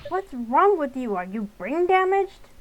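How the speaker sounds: a father berating his daughter; angry dad. Acted. Dad scolding in car.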